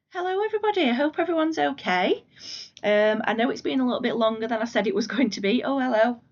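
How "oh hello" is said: normal talking voice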